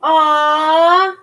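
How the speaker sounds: high, loud cooing aww; fond aww. Real, not acted. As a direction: coo at the screen fondly. Reacting fondly to viewer comment.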